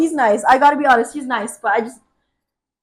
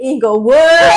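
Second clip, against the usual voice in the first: Inglewood? shouted louder with a rising, disbelieving tune; loud incredulous echo; 4 semitones higher, 12 dB louder, slower than usual. Retold or imitated. Retelling the flirting coworker's invite, a loud disbelieving echo. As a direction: blurt it back like you can't believe it. Repeating the neighborhood name for effect.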